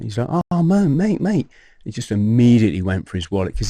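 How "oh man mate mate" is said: high-pitched mock concern; imitated sympathetic dismay. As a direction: pitch it high, fussing over a mate. Imitating sympathetic drunk friend.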